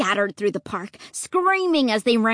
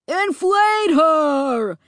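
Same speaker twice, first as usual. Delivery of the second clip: drawn-out, raised yell of command; 4 semitones higher, 4 dB louder, much slower than usual. A narrator's reading. Horror in an angry crowd yells an order. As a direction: bellow it slowly, stretching each word. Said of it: "one of them yelled".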